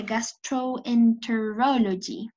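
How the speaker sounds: "gastroenterology" slow and deliberate; slow clear term. Shown as a demonstration. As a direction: model "gastroenterology" for the class. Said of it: "Entonces sería". ("gastroenterology" lenta y marcada)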